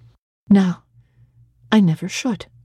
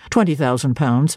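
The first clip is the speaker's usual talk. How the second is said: lower, offhand "Twenty thousand pounds" as a trifle; Rivers' low offhand voice; 6 semitones lower than usual. A narrator's reading. Clergyman telling Jane her fortune, offhand and low.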